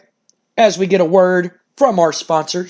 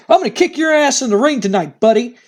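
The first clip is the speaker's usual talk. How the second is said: Al Snow challenge; 8 semitones higher than usual. Retold or imitated. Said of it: "jumps in front of him and goes".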